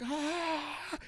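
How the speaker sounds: high, soft imitated squeal; mock scream. Imitating her getting drenched, high mock scream. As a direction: squeal thinly as if hosed down. Imitates woman being sprayed.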